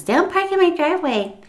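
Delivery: hammed-up order to stay off her driveway; stern warning. Old lady warning the caregiver off her driveway, mock stern.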